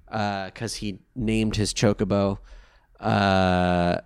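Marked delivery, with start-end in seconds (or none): none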